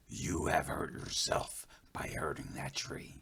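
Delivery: soft, low whisper; whispering spirit voice. Acted. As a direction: hush it like a wise elder. Running Bear speaks softly.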